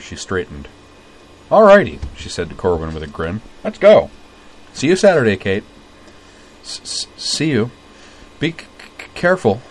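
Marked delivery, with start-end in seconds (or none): 1.5-2.0 bright, much louder and higher; cheery exclaim. Acted. Utna heading off with Corwin, cheery loud exclaim. 3.6-5.6 bright, much louder and higher; cheery exclaim. Acted. Utna heading off with Corwin, cheery loud exclaim. 6.6-9.6 stammering shyly. Acted. Caitlin shyly welcoming Jury along, stammering.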